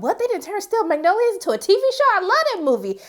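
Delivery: high, bright gasp of recognition; a thrilled double take at the title; surprised delight. Retold or imitated. Excited thought on seeing title.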